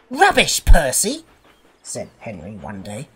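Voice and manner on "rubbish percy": loud, high scoff; scornful dismissal. A narrator's reading. Henry rebuking Percy's wish for a scarf, loud scorn. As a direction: dismiss him loudly and scornfully.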